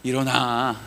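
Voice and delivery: low, soft, drawn-out comforting; gentle coaxing. Acted. God comforting Joshua as option two, low tender coaxing. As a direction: coax someone tenderly to their feet. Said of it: "이러는 것 같으세요?".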